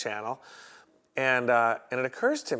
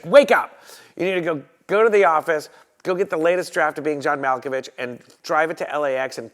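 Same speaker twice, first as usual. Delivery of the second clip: imitating a boss yelling orders down the phone; loud, high wake-up shout, then forceful orders; yelling agent; 4 semitones higher, 9 dB louder than usual. Agent rouses her assistant late at night, yelling. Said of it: "she calls and wakes up her assistant".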